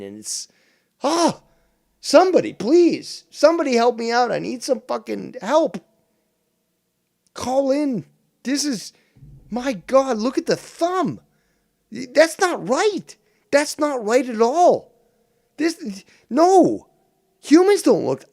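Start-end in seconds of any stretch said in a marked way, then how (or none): 1.0-5.8 high, loud, hammed-up cry for help; mock panic. Streamer begging viewers for help, mock panic. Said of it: "I'm starting to panic here". 7.3-18.2 high-pitched, hammed-up freak-out; mock panic. Streamer freaking out over a thumb, mock panic. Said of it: "I'm starting to panic here".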